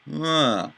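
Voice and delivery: "Ma." dropping down from high; falling tone. Shown as a demonstration. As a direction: say "Ma." with a clear downward fall. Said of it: "an inflection down".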